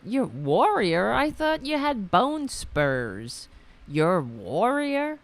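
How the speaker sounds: slow, mocking disbelief with rising emphasis; scornfully incredulous, stretched out; incredulous scoff. Real, not acted. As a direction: draw out the challenge in mock astonishment. Scoffs at Trump's warrior claim.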